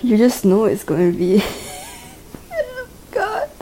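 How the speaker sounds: laughing through pain. Real, not acted. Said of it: "when you're laughing through the pain".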